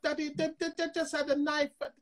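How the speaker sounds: put-on high small-child voice explaining herself; lightly voiced toddler, innocent excuse; little daughter voice. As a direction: pitch way up like a three-year-old making excuses. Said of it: "she got".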